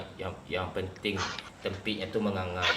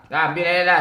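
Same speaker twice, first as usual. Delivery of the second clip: loud; 8 semitones higher, 12 dB louder, faster than usual. Real, not acted. Yelling in game.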